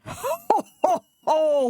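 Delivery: high hearty ho ho ho; booming laugh. A narrator's reading. Gordon laughing at Thomas down the hole, hearty laugh. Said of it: "laughed Gordon".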